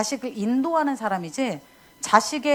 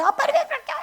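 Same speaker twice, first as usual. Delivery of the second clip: high whiny pleading; whiny child voice; much faster than usual. Retold or imitated. Child begging father to wake them quickly, high whine. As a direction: whine it like a sleepy kid.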